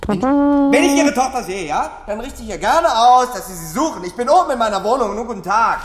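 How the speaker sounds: viel höher und lauter, empört; empört und schrill höher zurückgeben. Acted. Nachbar wird empört, schreit. (empörter, lauter Nachbar)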